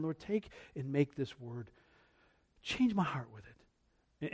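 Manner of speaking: slow, soft, low plea; hushed prayer. Retold or imitated. Believer opening Scripture asks God for change, hushed. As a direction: pray it slowly, almost to yourself.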